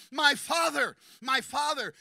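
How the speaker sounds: loud, high cry full of love; tender but loud cry; warm cry. Acted. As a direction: cry out to a beloved father. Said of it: "Listen to the warmth in his voice when he cries out".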